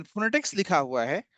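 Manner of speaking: ordinary talk, nothing marked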